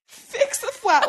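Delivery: laughing. Real, not acted. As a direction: laugh the word out. Laughing at absurd detail.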